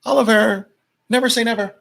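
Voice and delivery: a light impression of mom chiding Oliver; mock mom scolding. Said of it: "I can hear my mom again".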